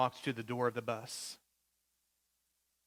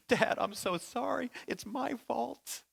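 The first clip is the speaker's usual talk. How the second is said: higher, slow, broken apology; tearful apology; 9 semitones higher, slower than usual. Retold or imitated. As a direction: barely get the words out to your father. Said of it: "She starts into her little speech".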